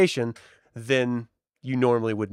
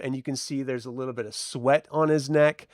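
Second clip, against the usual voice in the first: normal talking voice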